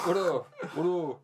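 repeating the name through real laughter; laughing while correcting a name. Real, not acted. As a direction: giggle the name out.

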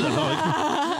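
high-pitched laughing welcome; laughing announcement. Acted. Host welcomes guest with a high laughing voice.

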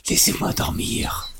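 hushed, slow whisper from behind; whispered plea. Retold or imitated. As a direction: whisper it like someone half asleep. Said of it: "Phil, derrière". (chuchotement lent et endormi)

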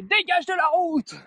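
high, slow furious yell; angry shout. Retold or imitated. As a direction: bark at him to get off the road. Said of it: "Il ouvre la fenêtre et fait".

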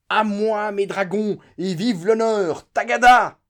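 high, very loud charge cry, slowing on honneur; raging shout. A narrator's reading. General calling his dragoons to charge, raging shout. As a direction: roar it like a cavalry charge. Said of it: "il cria comme un enragé".

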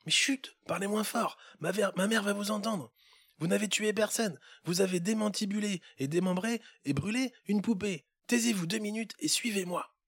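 put-on a kid hushing someone anxiously; hushing little boy. Boy hushing the elf so mother won't hear, high urgent.

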